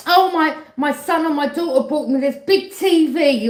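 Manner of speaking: high loud mock boast; bragging parent. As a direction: show off the gift in a shrieking brag.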